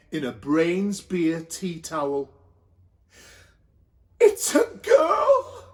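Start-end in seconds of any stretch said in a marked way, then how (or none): 4.2-5.6 very high, slow, teary cry; tearful announcement. A narrator's reading. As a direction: announce it through tears. Announcing newborn baby.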